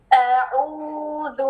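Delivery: اعوذ drawn out very slowly, two counts on the وذ; correct recitation. Shown as a demonstration. Teacher modeling two-count lengthening, drawn out. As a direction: stretch the vowel long. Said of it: "کیسے پڑھیں گے؟".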